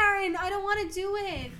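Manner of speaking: high, loud whine; whiny protest. Real, not acted. Playful protest at doing the accent.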